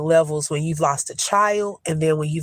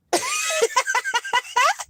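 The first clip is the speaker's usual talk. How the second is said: high shrieking laugh; 3 dB quieter than usual. Real, not acted. Laughing at the translation.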